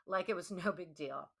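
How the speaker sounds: ordinary delivery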